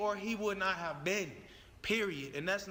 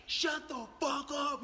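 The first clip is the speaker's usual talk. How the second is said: drawn-out, higher-pitched retold yell; mock police yell; 5 semitones higher, slower than usual. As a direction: imitate an officer yelling without full volume. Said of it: "they all yelling at you".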